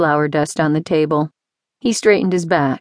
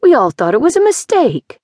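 much higher, louder exasperation; Mimsy exasperated with Gus, loud and high; 10 semitones higher, 5 dB louder than usual. Acted. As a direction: throw it at him in exasperation.

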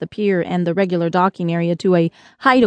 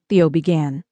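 plain reading voice

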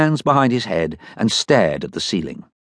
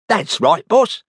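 Dud Check's voice; 13 semitones higher, slower than usual. Acted.

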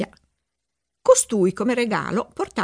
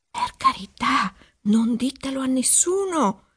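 urgent plea; 5 semitones higher than usual. Pretend. Narrator begs listeners to keep secret.

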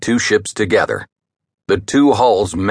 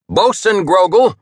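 high, loud call that cuts through; calling out sharply; 11 semitones higher, 4 dB louder than usual. A narrator's reading. Captain stopping the boatswain's axe, sharp call. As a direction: snap the name out to halt him.

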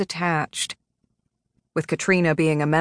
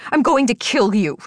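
higher, louder furious threat; raised, enraged voice; angry shout; 5 semitones higher, 5 dB louder than usual. A narrator's reading. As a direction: shout the threat at your captor. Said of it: "she shouted".

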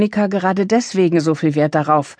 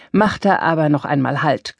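usual speaking voice

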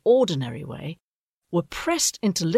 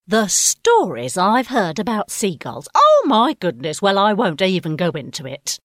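scandalised gossip; 4 semitones higher, 7 dB louder than usual. A narrator's reading. Gossiping snake maid.

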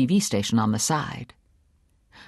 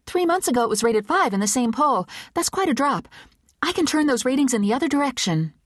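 high, fast, holding back a shaky voice; fighting a quaver; 11 semitones higher than usual. A narrator's reading. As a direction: keep the tremble out. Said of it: "struggled to keep her voice from quavering".

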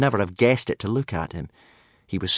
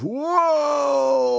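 long high roared "whoa"; drawn-out bellow, pitch way up; mighty roar. A narrator's reading. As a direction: roar it like reining in beasts. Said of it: "with a mighty roar of".

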